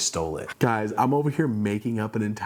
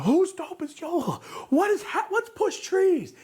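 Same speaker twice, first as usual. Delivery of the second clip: much higher, confused, almost crying; baffled squeal. Retold or imitated. Owner imitated, baffled by the fan backlash, high and confused. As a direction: squeak it out like you have no clue what hit you.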